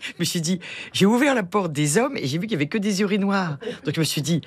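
usual speaking voice

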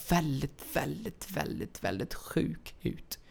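fading out. Real, not acted. Fading repeated väldigt.

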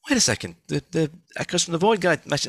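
high, retold jolt of remembering; sudden realisation. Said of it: "I was like".

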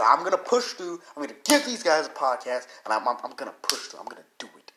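psyching yourself up to push through while sick; determined pep talk. Retold or imitated. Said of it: "I said".

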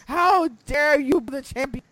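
a hint of theatrical indignation; mock outrage. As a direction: pretend to be offended for the champion. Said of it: "I was like".

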